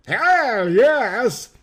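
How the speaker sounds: high, eager cheer; excited. A narrator's reading. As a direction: cheer it out gladly. Eager yes to wedding plan.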